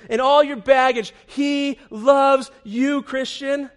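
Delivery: loud, slow, ringing proclamation; preached at full force. Real, not acted. Preacher to congregation, a loud slow proclamation. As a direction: belt out each word slowly. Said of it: "don't miss it".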